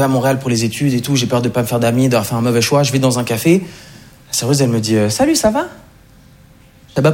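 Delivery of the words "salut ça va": chirpy waitress greeting. Retold or imitated.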